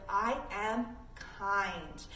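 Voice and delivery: very slow, deliberate affirmation; calm, drawn-out statement. Shown as a demonstration. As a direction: spell it out slowly for kids. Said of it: "we're gonna start with".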